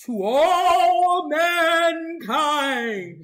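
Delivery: loud, long-drawn booming proclamation; alien announcer. Acted. Host mimics an alien addressing earth, grand alien proclamation. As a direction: stretch every word out loud and high. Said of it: "And the alien will go".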